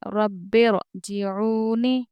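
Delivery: slow रब्बिरजिऊनी with a full, heavy ra; heavy ra recitation. Shown as a demonstration. Teacher reciting रब्बिरजिऊनी slowly for students. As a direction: stretch रब्बिरजिऊनी, ra said thick. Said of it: "अब हम यहाँ पर उसको पुर पढ़ रहे हैं".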